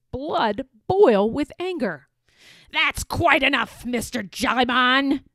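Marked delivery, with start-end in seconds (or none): 2.7-5.2 loud, angry pirate captain; pirate woman yelling. Acted. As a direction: snap at him like a pirate boss. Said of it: "she yelled".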